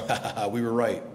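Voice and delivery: mock "ha ha ha" of triumph; gloating laugh.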